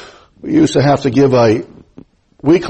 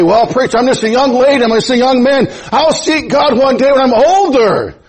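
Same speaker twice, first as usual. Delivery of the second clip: mock youth excuse; 8 semitones higher than usual. Voicing young excuse-maker to preacher.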